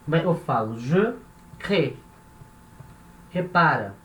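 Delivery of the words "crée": crée cut to one syllable, "crê"; silent final E. Shown as a demonstration. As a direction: say "crée" with the last E dropped. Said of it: "não vai ser pronunciado".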